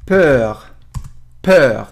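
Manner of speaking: پر said slowly with rounded French eu vowel; French peur. Shown as a demonstration. Teacher showing students French peur, carefully pronounced. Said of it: "این صدای او و ای که کنار هم میاد".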